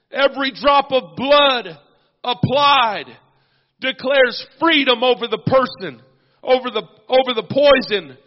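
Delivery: loud, rising preacher's call and response; full-force preaching. Acted. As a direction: build it up, shouting the cries of sin and blood.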